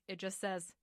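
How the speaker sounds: normal talking voice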